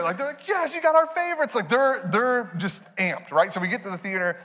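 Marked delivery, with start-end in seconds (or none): 0.5-1.5 put-on high-pitched, excited kid; losing it. Kids thanking parents for snacks, high excited squeal. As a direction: squeal it like a thrilled child. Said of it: "And they're losing it".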